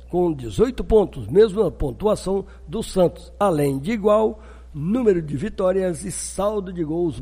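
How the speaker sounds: brisk, bright news bulletin; news read. Acted. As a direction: read it like a sports news anchor.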